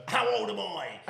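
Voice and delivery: mock daft yell. Imitates drunk passenger on plane.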